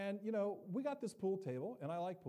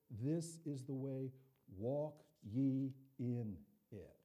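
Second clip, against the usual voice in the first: gentle suggestion; 5 semitones lower, much slower than usual. Shown as a demonstration. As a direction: coax it gently, no demand. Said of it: "It's suggestive".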